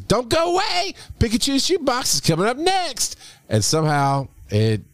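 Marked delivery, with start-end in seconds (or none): 0.0-3.2 high, bright jingle announcer voice; nineties announcer. Shown as a demonstration. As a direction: sing out the bumper like a nineties ad. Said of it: "That's supposed to be the whole nineties".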